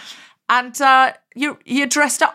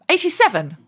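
surprised; 8 semitones higher than usual. Real, not acted. Reacting to a surprising age.

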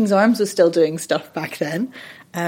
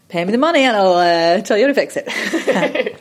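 louder, laughing mock demand; joking demand; 2 semitones higher, 3 dB louder than usual. Voice coach to someone wanting free advice, laughing. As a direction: break into laughter on a cheeky offer.

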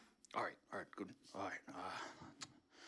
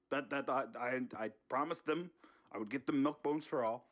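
Bernie voice; 2 semitones higher than usual. Acted. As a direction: play Bernie caught red-handed, all innocence.

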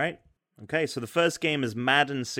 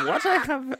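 man doing granny voice; 13 semitones higher than usual. Pretend. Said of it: "Can you do an old lady voice?".

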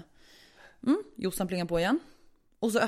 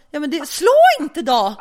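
loud, raised voice snapping back; exasperated retort; 6 semitones higher, 10 dB louder than usual. Retold or imitated. Tenant to neighbour who bangs on ceiling, exasperated. Own side of quarrel.

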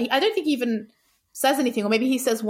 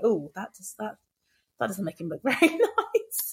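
mild mock dismay. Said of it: "I was like".

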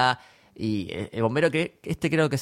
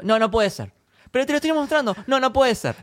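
high-pitched mock back-and-forth argument; retold quarrel, voice pitched up high; acted stubborn denial; 10 semitones higher than usual. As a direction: act out an exasperated refusal to believe it. Acted argument with denying villain.

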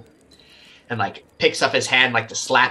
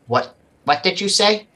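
higher, a hint of shocked disbelief; lightly voiced stunned young man; Connor voice; 8 semitones higher than usual. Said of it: "he's like".

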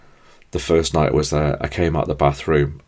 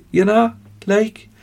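much higher, slow Geordie "you know, like"; Geordie accent; 3 dB louder, slower than usual. Shown as a demonstration. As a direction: tack on a playful Geordie tag. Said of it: "kind of slip into a Geordie accent".

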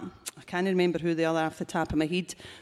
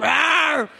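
mock roar; 7 semitones higher, 6 dB louder than usual. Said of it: "this big roar".

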